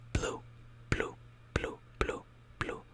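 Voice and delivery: blue said short, the U cut off; Italian blu. Shown as a demonstration. Teacher modelling the Italian blu. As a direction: clip blue short, half the U. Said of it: "accent on the U, so it's gonna be quick".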